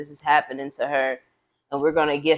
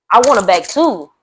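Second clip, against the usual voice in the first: louder, firm insistence; emphatic; 5 semitones higher, 10 dB louder than usual. Real, not acted. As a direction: say it with conviction. Emphatic own stance, loud.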